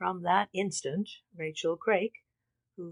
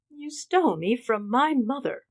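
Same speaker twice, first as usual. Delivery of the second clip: higher, louder, despairing cry; despairing sob; 6 semitones higher, 6 dB louder than usual. A narrator's reading. Winifred to the woman who raised her, despairing sob. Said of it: "sobbed Winifred despairingly".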